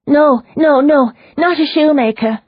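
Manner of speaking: high, loud frantic denial; agitated. Acted. Prisoner frantically corrects Leona, high and loud. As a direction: shake off the wrong word in a panic.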